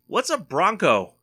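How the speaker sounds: called out loud across the lanes, mock innocent; playful yell. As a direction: yell the question over to the next car, teasing. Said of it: "I yell over to her".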